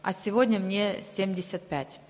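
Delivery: ordinary talk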